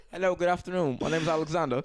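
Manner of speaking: put-on light, polite English accent greeting. New arrival greeting customers in light English accent. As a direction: introduce yourself in put-on English. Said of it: "English accent".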